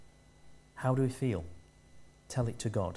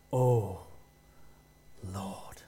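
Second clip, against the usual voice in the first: mock prayer voice; 5 semitones lower than usual. Shown as a demonstration. Preacher imitating Brian's put-on prayer voice, drawn-out and low. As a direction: stretch "Oh ... Lord" like a pious show-off. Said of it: "Every vowel was lengthened, and there were some nice pauses in between".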